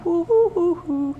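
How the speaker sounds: high, playful hooting as if losing her mind; mock crazy hoots. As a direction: hoot like she's going nuts, lightly mocking. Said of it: "I thought I was going".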